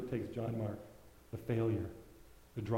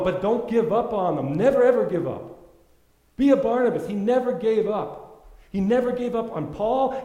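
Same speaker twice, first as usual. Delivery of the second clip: preaching at full force; 7 semitones higher, 8 dB louder than usual. Acted. Preacher urging the congregation never to give up, full-force preaching. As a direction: drive it home to the whole room.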